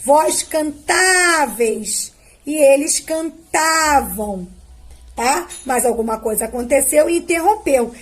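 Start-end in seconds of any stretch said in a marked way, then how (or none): 0.0-4.5 slow, stretched out, marking the accent; slow conjugation drill. Shown as a demonstration. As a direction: drag each verb out for the pupils. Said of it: "Então, olha o acento". (conjugação bem lenta, marcando o acento)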